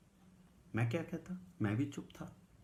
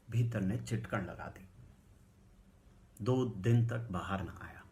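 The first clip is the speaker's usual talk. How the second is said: ordinary delivery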